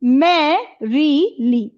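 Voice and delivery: मैं री ली very slowly, syllable by syllable, stress on मैं; stressed first syllable. Shown as a demonstration. Teacher shows a dactyl to pupils, मैं stressed, री ली separated. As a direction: lean on मैं, then let री ली fall away. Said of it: "stress दे रहे हैं".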